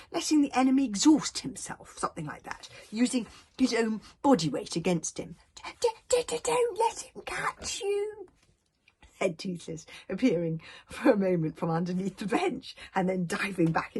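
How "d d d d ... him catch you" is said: stammering little dragon. Acted. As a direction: squeak the warning from hiding. Toothless stammering warning.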